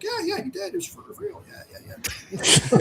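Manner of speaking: high, hushed mock go-along voice; mock phone brag. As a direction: pitch it way up and play along, sly. Mock phone confirmation for batter.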